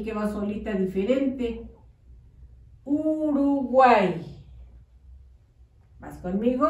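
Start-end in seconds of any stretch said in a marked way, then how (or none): 2.8-4.2 "Uruguay" stretched out as one word; "Uruguay" drawn out with a long first U; slow read-back. Shown as a demonstration. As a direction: read "Uruguay" slowly for children writing. Teacher reads back dictated word.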